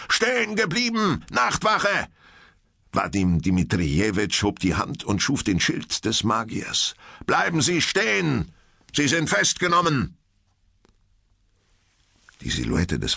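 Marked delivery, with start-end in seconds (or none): 0.1-2.1 high, urgent challenge; commanding call. Acted. Watchman orders charging vampire to halt. 7.3-10.2 high, called-out arrest order; urgent command. Acted. Teacher orders vampire's arrest.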